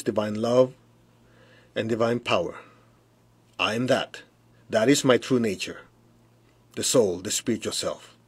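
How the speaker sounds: guided meditation. Acted.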